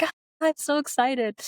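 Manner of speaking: put-on high, squealing kid who just spotted a parent; mock kid excitement. Imitating kids in TikToks who spot their parent, high and excited. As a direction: gush like a thrilled child. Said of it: "they're like".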